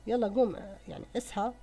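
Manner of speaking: usual speaking voice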